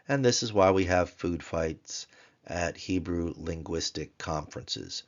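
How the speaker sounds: ordinary talk, nothing marked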